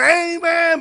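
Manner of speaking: loud, high shouted amen; crowd amen. Retold or imitated. Imitating the congregation's shouted amen. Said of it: "막 이러고요".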